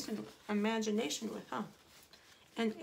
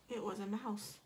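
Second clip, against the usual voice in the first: plain reading voice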